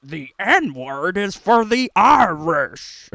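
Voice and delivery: slow, dopey mock voice; a slow, loud mock voice in a jeering quote; mocking impression. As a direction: play him up as a clueless fool, slowly. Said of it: "That's the voice now".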